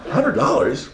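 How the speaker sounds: high, stunned echo of the price; incredulous question. Retold or imitated. At his kitchen table, echoing the price in shock. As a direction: repeat it like you can't believe it. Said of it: "I'm like".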